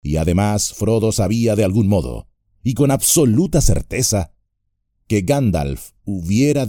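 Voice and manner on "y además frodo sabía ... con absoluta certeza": ordinary delivery, nothing marked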